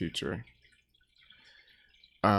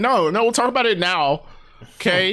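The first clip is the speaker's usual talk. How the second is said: higher-pitched mock demand; mock insistence; 7 semitones higher, 3 dB louder than usual. Viewer demanding Marvel explain it now, mock insistent. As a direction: put your foot down, playfully insistent. Acted demand to the show's makers.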